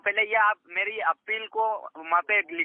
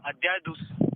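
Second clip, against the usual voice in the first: voice cutting out; 3 semitones lower than usual. Real, not acted. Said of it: "कट रही है आपकी आवाज़".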